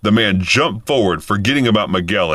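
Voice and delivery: ordinary delivery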